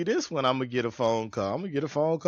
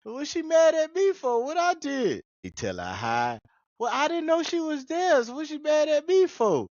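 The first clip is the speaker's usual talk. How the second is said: dad confused; 13 semitones higher than usual. Retold or imitated. Dad on the phone about his wife, high baffled protest. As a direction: play the clueless dad, pitched up. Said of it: "Cat over here sitting there mad".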